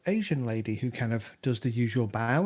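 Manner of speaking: ordinary talk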